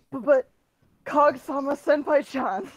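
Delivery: hammed-up excited plea. A narrator's reading. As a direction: cry it out in mock excitement. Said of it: "I cried excitedly".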